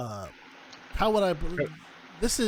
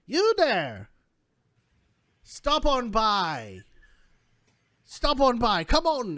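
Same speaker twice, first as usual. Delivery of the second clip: high, slow beckoning crone call; old fortune teller; 8 semitones higher, slower than usual. Acted. Old woman calls to the passing cart, high beckoning crone voice. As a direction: call out high, drawing the words out.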